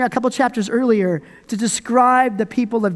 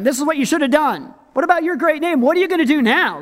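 higher, insistent nagging; mock counseling; 5 semitones higher, faster than usual. We counsel God after defeat, mock counseling. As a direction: press God with know-it-all questions. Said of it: "start counseling God".

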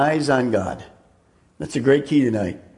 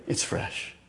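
whispered; 13 dB quieter than usual. Shown as a demonstration. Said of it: "preacher whispering repeated phrase".

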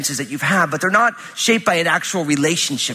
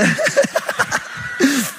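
high burst of laughter. Real, not acted. Laughs mid-sentence.

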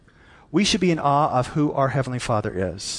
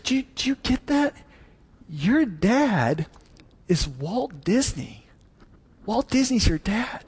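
lightly voiced teacher, higher, pausing for emphasis; teacher spelling it out; 7 semitones higher than usual. Teacher tells little girl who her dad is, spelling it out. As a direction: gently voice a teacher breaking big news. Teacher breaks big news to pupil.

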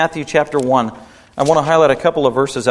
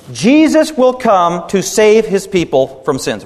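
emphatic preaching; 4 semitones higher, slower than usual. Acted. Preaching states the mission.

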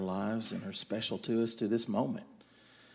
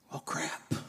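a hushed, voiceless oh crap; whispered dismay; 8 dB quieter than usual. Retold or imitated. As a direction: whisper the realisation to yourself. Realising he was angry.

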